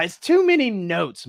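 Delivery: lightly voiced emperor pushing "too"; emperor's complaint.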